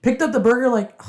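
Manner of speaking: normal talking voice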